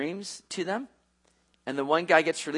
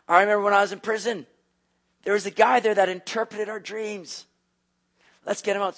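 sudden excited realization; 5 semitones higher, 3 dB louder than usual. Acted. Man suddenly remembers Joseph.

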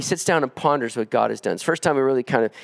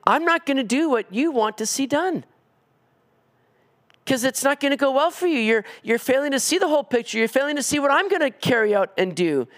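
higher, lightly voiced gentle God; God gently explaining a no, higher voice; voicing God; 10 semitones higher than usual. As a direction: speak God's answer patiently, a little higher. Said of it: "by him saying".

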